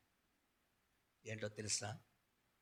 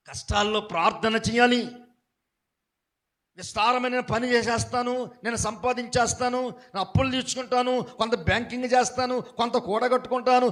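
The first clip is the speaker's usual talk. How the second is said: raised, driving sermon voice building up; preacher warning the church, pressing harder and louder; fervent preaching; 9 semitones higher, 13 dB louder than usual. Acted. Preacher builds to full force.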